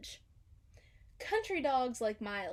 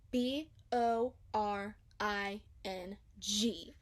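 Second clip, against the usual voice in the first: B O R I N G slow, letter by letter; spelled out; 2 semitones lower, slower than usual. A narrator's reading. Narrator spelling out how boring the waiting was. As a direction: spell each letter slowly. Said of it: "Boring in all capital letters".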